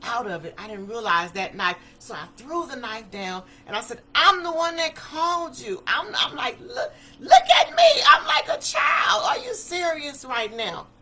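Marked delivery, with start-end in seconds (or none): 4.1-10.9 high, loud cry of disbelief; re-enacted desperate outcry, peaking in a shriek; frantic protest. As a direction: plead at full voice, close to tears. Pleading with police aiming guns.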